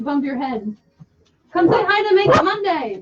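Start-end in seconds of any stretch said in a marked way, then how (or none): 1.5-3.0 calling out. Real, not acted. Calling friend to the camera.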